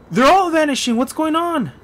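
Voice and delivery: high, loud panicked cry; sudden alarm, voice shoots up; shocked. Real, not acted. Everyone disappears suddenly.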